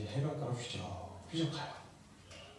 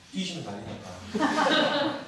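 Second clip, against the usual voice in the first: laughing panic; 7 semitones higher, 8 dB louder, much slower than usual. Acted.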